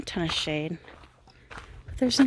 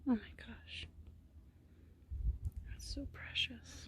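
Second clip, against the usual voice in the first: whispered delight at the rabbit; awed whisper; 4 semitones higher, 16 dB quieter than usual. Real, not acted. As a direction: hush your wonder. Whispering while watching the rabbit.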